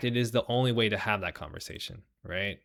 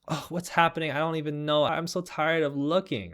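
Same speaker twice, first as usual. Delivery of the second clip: high, airy put-on voice of a self-absorbed woman; sarcastic high impression of a tired bystander; mock airy complainer; 6 semitones higher than usual. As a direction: mimic a fake-innocent whine, pitched up. Mocking privileged women's complaint.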